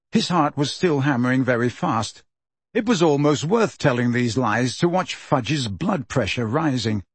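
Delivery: ordinary delivery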